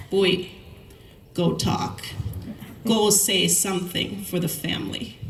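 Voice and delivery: lightly voiced Thai English accent, ordering his son to go speak; father's Thai English accent. Dad nudging his son to speak at the hearing, in a Thai English accent. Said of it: "beautiful Thai English accent".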